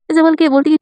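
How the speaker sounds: usual speaking voice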